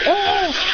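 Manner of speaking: short high yell; angry scream. Acted. Incinerating the Nomu with such anger, high angry scream. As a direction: cry out in acted rage. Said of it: "with such anger".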